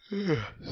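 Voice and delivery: long weary sigh, pitch sliding down; a sigh of someone who has lost the thread; big sigh. Real, not acted. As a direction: let out a heavy tired sigh. Tired, trails off, apologizes.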